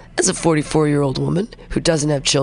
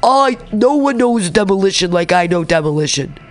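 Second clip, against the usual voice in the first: lightly mocking boast, pitched up; Donald Trump; 3 semitones higher than usual. Host quoting a politician on demolition, lightly mocking. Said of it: "about him talking about".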